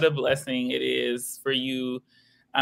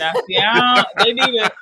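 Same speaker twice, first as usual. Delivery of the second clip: mock preacher; 6 semitones higher, 5 dB louder than usual. Mock preacher demanding tithes.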